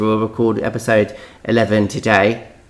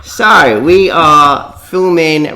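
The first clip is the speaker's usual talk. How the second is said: loud announcement; 6 semitones higher, 7 dB louder, much slower than usual. Real, not acted. Launching into the episode.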